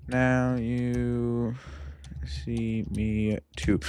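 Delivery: very slow, level, drawn-out syllables; typing aloud. Real, not acted. Host typing a search about the director, slow and drawn-out. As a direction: stretch each word as you type it.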